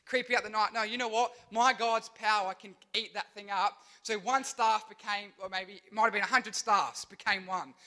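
ordinary talk

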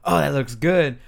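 full-voice remark, a bit high; imitated loud whisperer. Host mimicking Miles during previews, full normal voice. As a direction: imitate someone failing to whisper. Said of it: "just normal voice".

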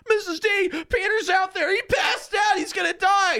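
very high, loud mock panic; panicked student. Student bursting in to tell teacher, mock panic. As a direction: shout it hysterically, hammed-up.

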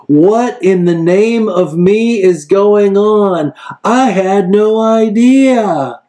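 high, slow, hammed-up baffled disbelief; mock surprise. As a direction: play a flustered God, drawn out. God imagined caught off guard.

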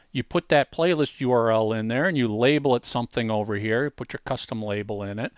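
normal talking voice

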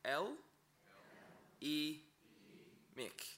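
L, E, Mick spoken one syllable at a time with pauses. Shown as a demonstration. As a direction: spell out L, E, Mick slowly, syllable by syllable.

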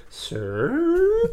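put-on very high squeaky little girl "Sir?". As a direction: squeak like the creaky chair girl. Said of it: "it was like".

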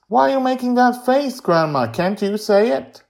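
put-on high, loud child's challenge; challenging young Joy. A narrator's reading. Girl dares her grandma to curse, high and challenging. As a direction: needle her in a high kid voice.

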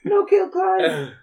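high, wailing plea for mercy; begging goblin. Acted. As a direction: whine and beg desperately, slowly. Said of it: "Clive immediately throws down his weapons and is like".

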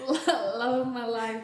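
laughing through the words; giggling as she says it. Real, not acted. Laughing at own imagined line.

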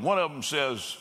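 ordinary talk, nothing marked

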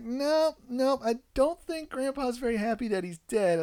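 high, firm mock-correcting voice; lightly amused parent correction; correcting kid. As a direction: shut it down lightly. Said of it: "I'm like".

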